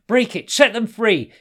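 high, urgent shouted command; loud, high-pitched rallying cry. A narrator's reading. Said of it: "cried Hassan".

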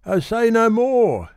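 put-on high, loud, pleading young woman; a high plea, "oh" stretched and falling; Mabel pleading. A narrator's reading. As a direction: protest high and breathless, like a flustered girl. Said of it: "she said".

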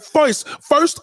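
first said as foist then first said as first. Retold or imitated. Contrasting New York foist with standard first.

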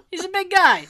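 ordinary talk

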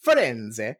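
"Firenze" drawn out slowly, Italian style; "Firenze" in Italian, ending in eh; Italian pronunciation. Shown as a demonstration. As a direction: say "Firenze" as fee-REN-tseh. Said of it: "is how you say Florence".

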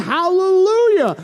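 high, drawn-out hallelujah shout; slow, soaring acclamation; shouted praise. Acted. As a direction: cry it out like a heavenly multitude. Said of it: "like the loud peals of thunder shouting".

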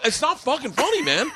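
high-pitched wounded protest; worked-up complaint, played for comedy; retold exasperation. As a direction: plead indignantly with a laughing friend. Retold quarrel, friend is laughing.

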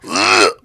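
low retching ugh; mock retching groan. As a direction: imitate a dry heave. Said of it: "I'm fucking like".

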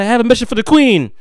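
put-on a kid trying to sound grand; overly proper kid. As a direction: puff out the chest, talk proper. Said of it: "they try to talk so proper".

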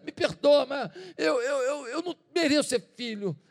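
tearful plea. Acted. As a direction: voice breaking into a cry. Son begs father, voice breaking.

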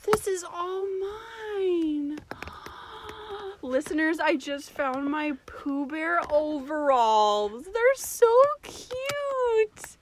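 high, slow gushing delight; excited squeal. Real, not acted. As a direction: squeal over something adorable. Finds childhood overalls in closet.

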